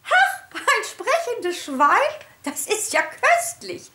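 high, slow twittering delight; high, slow amused chirping teacher. A narrator's reading. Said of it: "zwitscherte Frau Schneckmeier amüsiert".

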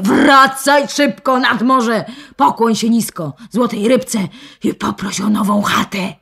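angry shrill nagging wife; shouting scold. Acted. Old wife yells at her husband, furious. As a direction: scold him as a fool. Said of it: "jeszcze głośniej niż wczoraj zaczyna krzyczeć".

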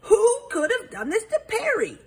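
high-pitched exasperated exclamation; crying out. A narrator's reading. Detective asking who hurt Perry, crying out. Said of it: "Hubert cried".

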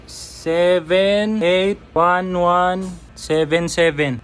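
reads each digit very slowly, x0.4, one at a time; slow digit reading. Pretend. Prankster stalling the impatient scammer with very slow digits. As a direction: stall the caller by spelling out digits painfully slowly.

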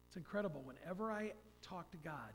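ordinary delivery

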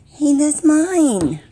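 loud, slow, drawn-out scolding call. Real, not acted. Scolding the creature that took her food, slow and loud. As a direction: stretch it out to shoo the thief off.

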